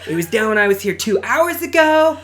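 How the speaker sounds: laughing mock rant; mock whiny customer. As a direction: play the fed-up customer whining at the counter.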